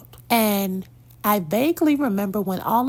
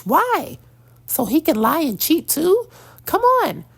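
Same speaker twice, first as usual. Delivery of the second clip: rising disbelief, higher and louder; incredulous outburst; 6 semitones higher, 3 dB louder than usual. Real, not acted. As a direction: pitch climbing higher and louder with each question. Indignant rhetorical challenge to mothers.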